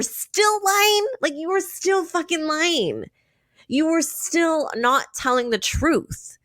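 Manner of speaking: rising, heated call-out, pitch climbing high; exasperated outburst. Real, not acted. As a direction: scold him straight to his face, fed up. Ranting at cast member on video.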